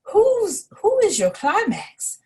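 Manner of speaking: a tiny mouse piping into an ear; high mouse asking a question. A narrator's reading. Mouse whispers into ringmaster's ear.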